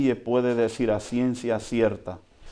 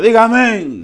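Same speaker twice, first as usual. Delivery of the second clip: high, loud call to the church; call for amen; 8 semitones higher, 8 dB louder than usual. Real, not acted. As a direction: rouse the congregation to answer. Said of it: "preacher calls for response".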